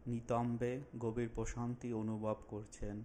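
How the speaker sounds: slow, drawn-out phrases with long pauses; unhurried relaxation instructor, lingering on soothing words; guided meditation. Acted. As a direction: guide them calmly through a body scan. Leads a guided body relaxation.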